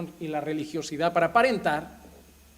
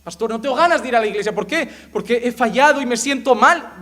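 pleading believer; 7 semitones higher, 10 dB louder than usual. Retold or imitated. Believer complains to pastor.

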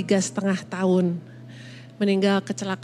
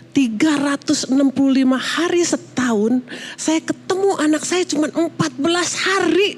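high-pitched, insistent counting of the days; retold indignant plea, pitch climbing; emphatic protest; 6 semitones higher than usual. Mother telling husband her time with children is scarce, emphatic protest. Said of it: "Saya bilang gini, "Denger ya".

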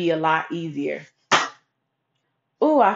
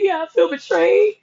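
much higher, teary admission; tearful; 9 semitones higher, 3 dB louder than usual. Retold or imitated. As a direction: confess the hurt through tears. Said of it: "So I'm crying to Mister Bruce".

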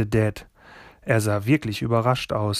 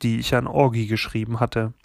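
plain reading voice

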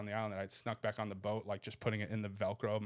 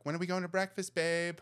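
put-on girly voice; 6 semitones higher than usual. Girl at door calling to buddy.

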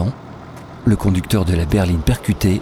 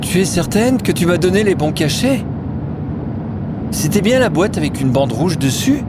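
put-on higher, anxious old woman's voice; worried Manou; 9 semitones higher than usual. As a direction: voice Manou's fretful doubt. (Manou inquiète, voix plus aiguë)

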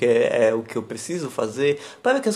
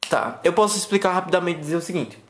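ordinary delivery

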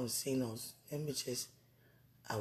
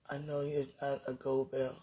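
ordinary talk

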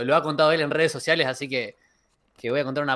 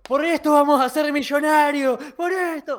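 higher, louder, hammed-up battle cry; mock yell; 10 semitones higher, 5 dB louder than usual. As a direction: yell it like charging into cold sea. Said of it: "íbamos gritando". (grito exagerado, más agudo)

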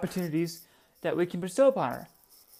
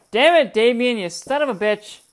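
louder, higher, exasperated scolding; scolding outburst; 7 semitones higher, 10 dB louder than usual. Real, not acted. Owner scolding her cat Damien, loud and exasperated. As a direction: tell the cat off.

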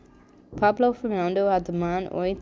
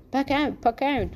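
higher-pitched, merry urging; cheerful call; 7 semitones higher than usual. A narrator's reading. Benito laughingly urges Max to open the present, cheerful. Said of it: "riep hij vrolijk".

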